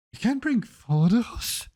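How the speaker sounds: high, slow stunned question; incredulous. Real, not acted. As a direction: ask it in sheer disbelief. Reacting in disbelief to banned items.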